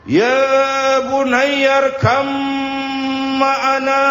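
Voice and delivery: long, drawn-out high call; teriak memanggil. Acted. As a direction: call down to someone far below, stretching every word. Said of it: "Kanan di bawah teriak". (seruan panjang bernada tinggi)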